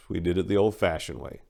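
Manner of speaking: ordinary delivery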